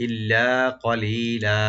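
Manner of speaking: slow, drawn-out chant; slow recitation. Shown as a demonstration. Teacher reciting the phrase slowly for students. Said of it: "ইল্লা হামজাকে right দিয়ে".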